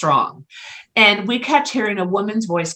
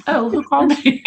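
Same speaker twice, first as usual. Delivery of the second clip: higher mock question with a laugh; 6 semitones higher than usual. Medium answering spirits when not paying attention, laughing. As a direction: imitate an absent-minded answer, amused.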